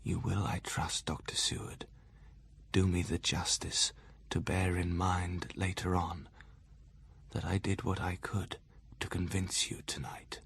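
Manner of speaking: soft, slow whisper; quiet whisper. A narrator's reading. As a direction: breathe it as a warning, barely voiced. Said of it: "he said to me in a quiet voice".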